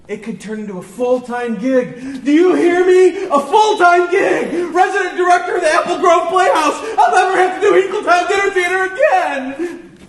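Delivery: building to a high, full shout; desperate outburst. Acted. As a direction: explode about the job you've wanted for years, drawing the words out. Desperate outburst about the job, then told off.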